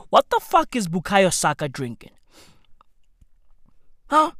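usual speaking voice

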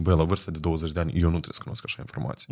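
quick, hushed thought, almost breathed; retold private thought, hurried and hushed; hushed inner thought. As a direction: mutter the thought to yourself, fast then fading. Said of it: "eu ziceam".

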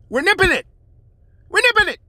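high loud nervous deputy; Don Knotts impression. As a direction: yelp it like a jumpy lawman. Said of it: "Like Don Knotts".

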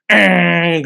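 imitated loud, raspy engine rev; throat-made roar of a motorbike exhaust; open-pipe motorbike noise. Speaker mimics the noisy motor that passed during recording, engine roar. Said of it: "naka-open pipe yung".

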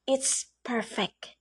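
soft sweet murmur, slow. A narrator's reading. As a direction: breathe it out delighted and soft. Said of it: "I said in a sweet voice of mine".

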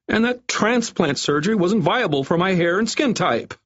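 high-pitched desperate whine; near wail. A narrator's reading. Doctor pleads failed hair treatments, near wailing. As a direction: keep wailing the excuse, high and plaintive. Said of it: "he all but wailed".

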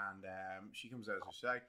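normal talking voice

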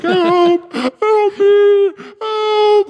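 loud, very high mock cries for help; hammed-up helpless shriek; imitated screaming. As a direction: wail it high and loud, mimicking a panicked friend. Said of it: "he is crying and screaming".

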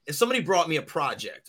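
ordinary talk

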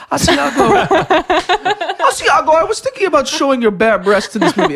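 laughing fake Italian accent; hammy Italian director voice; bad Italian accent. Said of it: "That's my Italian".

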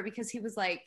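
ordinary delivery

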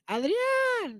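high, far-off call of his name up the street; girl calling his name. Retold or imitated. Imitating a girl calling for Adrián outside his house.

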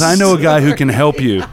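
high, loud mock voice with laughter; little guy's voice. Storyteller mimics the little guy offering help, high and loud. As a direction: imitate the little guy piping up.

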